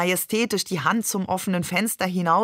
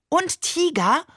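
much higher, thrilled little ask; eager question; 10 semitones higher, slower than usual. Acted. Excited little boy asking about tigers, eager high question. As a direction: ask hoping there really are tigers. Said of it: "fragte begeistert der Seestern".

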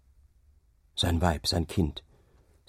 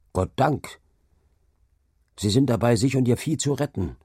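higher, relieved exclamation; a mildly raised cry of thanks; inward cry; 4 semitones higher than usual. A narrator's reading. Rider sees villagers escaping, inwardly crying out. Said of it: "rief es in ihm".

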